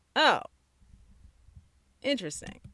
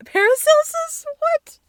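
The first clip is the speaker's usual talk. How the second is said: jumps much higher and louder on What; surprised; 15 dB louder than usual. Real, not acted. Reading Wikipedia to stream, shrieks in disbelief. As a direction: caught off guard by the article, yelps What.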